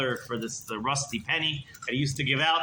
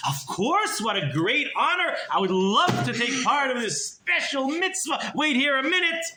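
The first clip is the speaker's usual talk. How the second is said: much higher, gushing welcome; fake-generous miser; 9 semitones higher than usual. Miser pretending delight at the mitzvah, much higher voice. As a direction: gush like it is a great honor.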